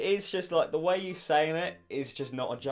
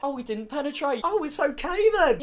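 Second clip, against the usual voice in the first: high, mock-relieved sarcasm; sarcastic; 11 semitones higher, 5 dB louder than usual. Real, not acted. Vlogger mocks the clip's excuse with high sarcasm. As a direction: pretend it's fine, dripping irony.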